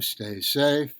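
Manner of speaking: ordinary delivery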